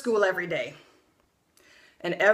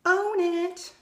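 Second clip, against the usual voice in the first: mock upbeat ad voice, pitched higher; ad slogan voice; 9 semitones higher than usual. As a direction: sell the slogan like a chirpy commercial. Mimicking ad slogan.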